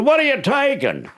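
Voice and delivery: very high, sharp question; startled homeowner. Retold or imitated. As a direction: demand it in alarm. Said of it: "He said".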